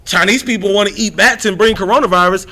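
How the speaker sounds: normal talking voice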